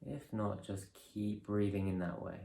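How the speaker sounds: calm, measured guidance with long pauses; guided meditation. Real, not acted. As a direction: ease them through each breath, unhurried and softening. Leads a breathing meditation.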